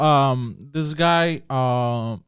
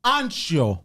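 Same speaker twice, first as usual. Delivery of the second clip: mock Italian; 2 semitones higher than usual. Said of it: "How do you say "me too" in Italian?".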